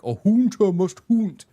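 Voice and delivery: high, loud, slow hammed-up delivery; mock dramatic quote. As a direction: overdo it the way fans imitate her. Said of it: "how a lot of people are like".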